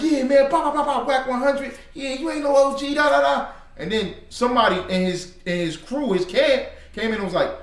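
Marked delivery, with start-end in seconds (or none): 0.0-3.5 high-pitched imitation of trash talk; mock confrontation. Preacher mimics one Israelite challenging the rapper, mock confrontational. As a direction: mimic a hothead running his mouth, pitched up.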